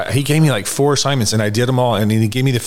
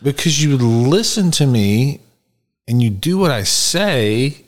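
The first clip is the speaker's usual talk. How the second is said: slow, deliberate, raised-pitch retelling; emphatic explanation; 7 semitones higher, 3 dB louder, slower than usual. Retold or imitated. Sponsor telling sponsee why he stands out, emphatic. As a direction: spell out each reason, drawn out and high.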